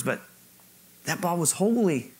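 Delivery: normal talking voice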